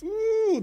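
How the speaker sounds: sudden high loud ooh; high squeal. Real, not acted. Caster joking about fast English, high squeal. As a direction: mimics fast talk going off the rails.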